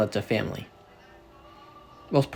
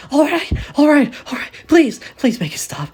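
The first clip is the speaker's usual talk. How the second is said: desperate pleading; 14 semitones higher, 7 dB louder than usual. Acted. Tortured detective begs.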